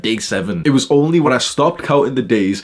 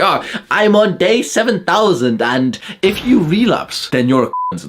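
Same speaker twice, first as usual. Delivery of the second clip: higher, slowed, smug sing-song; mock nofap guru; 7 semitones higher, slower than usual. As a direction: parody a preachy streak-bragger. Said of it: "that's what they say".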